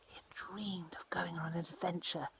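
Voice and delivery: hushed storyteller whisper about a secret dream. A narrator's reading. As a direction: breathe it like a secret. Narrator drops to a whisper.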